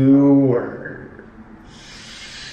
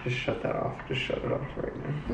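whispering; 13 dB quieter than usual. Real, not acted. Embarrassed by old clip.

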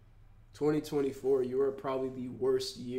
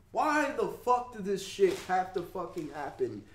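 anguished why; 5 semitones higher than usual. Real, not acted. Grief outburst.

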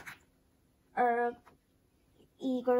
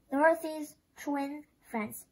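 usual speaking voice

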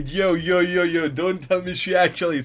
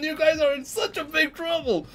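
normal talking voice

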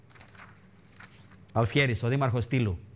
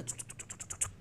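sneaky tsuk sounds; 18 dB quieter than usual. Retold or imitated. Mimicking sneaking through.

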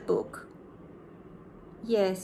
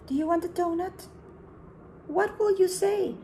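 quick, higher curious question; excited suspense; 8 semitones higher than usual. Acted. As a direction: build suspense for the kids. Teacher asks the children.

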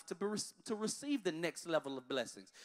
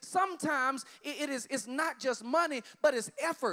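preaching; 7 semitones higher, 5 dB louder than usual. Acted. Pastor to congregation, preaching at full force. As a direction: call it out to the whole church.

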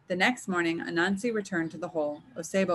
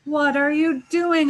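high, loud, drawn-out outcry; roaring; 9 semitones higher, 8 dB louder, slower than usual. A narrator's reading. Trapped leopard to Anansi as webs bind him, outraged roar. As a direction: roar it slowly, pitched well up. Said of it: "Osebo roared".

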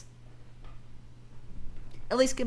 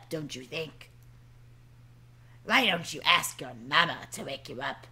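lightly voiced stern boss; 3 semitones lower than usual. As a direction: needle the late worker drily. Boss character lines.

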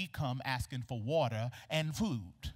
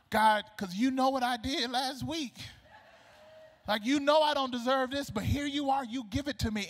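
overwhelmed gratitude; 9 semitones higher, 4 dB louder than usual. Acted. Acted overwhelmed prayer of thanks.

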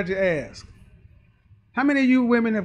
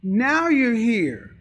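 mock sarcastic drawl; 4 dB louder, much slower than usual. Voicing men's reply to women.

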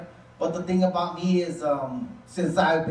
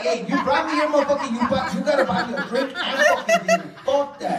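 high, laughing complaint; hammed-up outrage at the date; high laughing mock demand; mock indignant demand; 7 semitones higher than usual. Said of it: "I was like".

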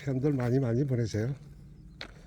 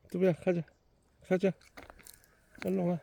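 talking to a dog; 7 semitones higher than usual. Real, not acted. Calling the dog to come.

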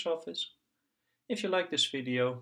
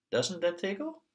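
put-on high child voice; 8 semitones higher than usual. Piggy asks her doll.

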